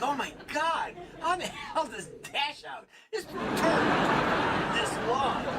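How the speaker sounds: high, loud, laughing disbelief; squealing over the size, laughing; shocked excitement. Retold or imitated. Texting a dog poop photo, laughing shocked squeal. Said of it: "'Cause I was like".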